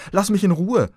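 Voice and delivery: ängstlich. A narrator's reading. Verängstigter Junge wehrt ab.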